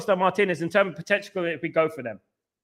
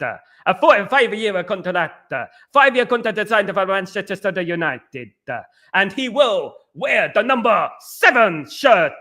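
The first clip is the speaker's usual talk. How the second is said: Fabrizio Romano accent. Shown as a demonstration. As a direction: announce a signing in a mock foreign accent, stretching the catchphrase. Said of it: "can you do an accent".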